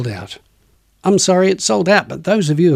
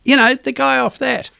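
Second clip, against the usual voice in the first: high, put-on voice of a random fan; squeaky voice of someone half recognising him; quoted bystander voice; 10 semitones higher than usual. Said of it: "they go".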